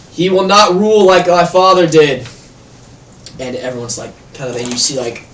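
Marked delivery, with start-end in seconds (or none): 0.1-2.3 loud, raised orator's voice; forceful public denunciation; booming proclamation. Acted. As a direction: declare it to the whole crowd. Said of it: "his voice is booming".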